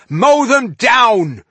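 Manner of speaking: drawn-out, high war cry; frenetic yell. Acted. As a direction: bellow the order to attack, slow and high. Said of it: "he yelled frenetically".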